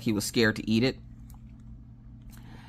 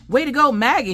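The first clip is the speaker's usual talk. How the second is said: high, loud congratulations; imitated cheer; 13 semitones higher, 5 dB louder than usual. Dad congratulates daughter on eating meat, cheering. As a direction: cheer the kid on, much higher.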